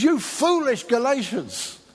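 drawn-out, indignant quote; angry rebuke. Retold or imitated. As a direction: imitate an exasperated apostle rebuking his church. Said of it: "It's his angriest letter".

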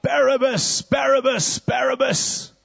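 high, loud crowd chant; crowd crying out. Acted. Crowd shouting for the prisoner, high and loud. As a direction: yell the name like a baying crowd. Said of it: "they're crying out".

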